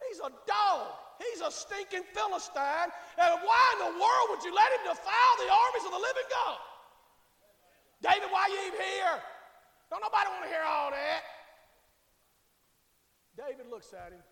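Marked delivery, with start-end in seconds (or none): 0.1-6.6 loud, high-pitched outrage; acted indignant outburst. David challenging the army about Goliath, indignant shouting. 8.0-11.2 raised, scornful scolding; belittling mockery. Older brother cutting David off, loud belittling. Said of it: "His brother started belittling him".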